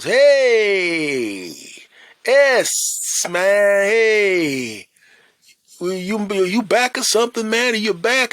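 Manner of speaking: high, loud, delighted greeting; excited shop owner. Retold or imitated. Shop owner greeting a returning regular, high and loud. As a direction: call out happily to an old regular. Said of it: "looks at me and goes".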